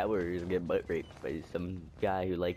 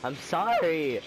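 startled loud apology; 6 semitones higher, 6 dB louder, much slower than usual. Real, not acted. Player startled by an inmate, blurting a loud apology. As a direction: blurt it out, louder and higher. Startled after being yelled at.

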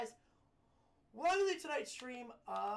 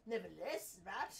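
indignant posh British client; snooty British gentleman, pompous and put out; posh British man; 2 semitones lower than usual. Acted. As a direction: scold the courier in haughty British tones. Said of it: "he sounds like a posh British man".

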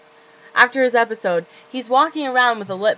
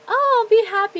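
mock happy thought; 14 semitones higher, 4 dB louder than usual.

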